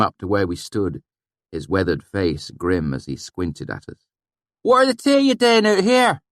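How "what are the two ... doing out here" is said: put-on suspicious valley accent. A narrator's reading. Swineherd questioning strangers, suspicious in thick valley accent. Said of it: "he said suspiciously".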